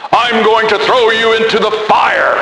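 half-hearted yell of a startled owner; mock yell. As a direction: owner yelling at the toe-biting cat, mock angry. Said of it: "jump up all at once and yell".

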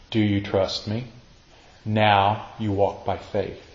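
soft, low, gentle voice; low and hushed, with tender patience; quiet reassurance. Acted. God answering the preacher's doubt, quiet and reassuring.

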